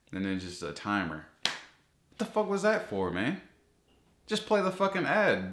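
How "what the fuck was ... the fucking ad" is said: high, exasperated outburst; angry rant. Real, not acted. Vlogger fed up with unskippable ads, angry high rant. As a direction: let the frustration blow up, pitched way up.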